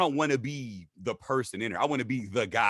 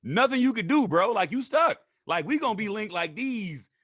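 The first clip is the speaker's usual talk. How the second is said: much higher, louder excited call; 11 semitones higher, 4 dB louder than usual. Real, not acted. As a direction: hype him up, bro. Excited bond declaration.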